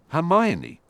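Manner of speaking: high, loud call of her name; yell. A narrator's reading. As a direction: shout for her to join in. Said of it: "he yelled".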